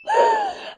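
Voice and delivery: sudden high gasp as tears come; a choked catch of breath; real tearful gasp. Real, not acted. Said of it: "I'm not crying".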